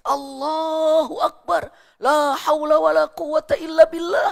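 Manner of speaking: high, slow wailing cry of Allahu Akbar. A narrator's reading. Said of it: "Umar bin Khattab menangis".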